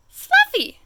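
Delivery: high, meek little Fluffy; small timid voice. Acted. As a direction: say your name like a shy little creature. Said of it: "said Fluffy".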